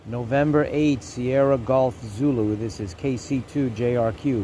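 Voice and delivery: ordinary delivery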